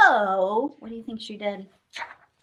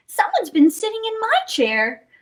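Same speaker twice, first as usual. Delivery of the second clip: Mama Bear; 10 semitones higher than usual. Acted. Mama Bear voiced in story.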